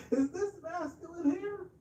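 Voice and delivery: laughing while asking, high and mocking. Real, not acted. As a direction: crack up mid-question. Mocking the pink pants photo.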